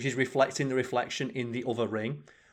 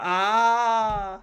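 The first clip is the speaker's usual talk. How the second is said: high, loud mock scream; imitated scream; 10 semitones higher, 7 dB louder than usual. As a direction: scream like someone flung off into space. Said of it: "screaming for, he's like".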